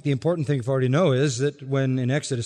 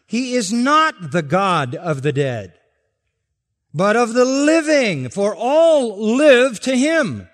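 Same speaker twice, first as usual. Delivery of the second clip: loud, higher, slow on "all live"; proclaimed verse; 9 semitones higher, 7 dB louder, slower than usual. Shown as a demonstration. Pastor reading the verse aloud to the church, loud proclamation. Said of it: "Verse thirty-eight".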